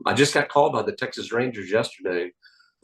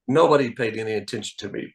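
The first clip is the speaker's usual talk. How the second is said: normal talking voice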